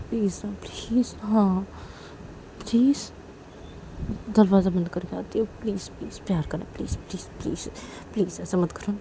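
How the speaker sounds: soft begging. Acted. Begging please softly.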